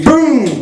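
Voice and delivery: high, booming hit noise; imitated punch impact. Brother catches him with a right, booming impact.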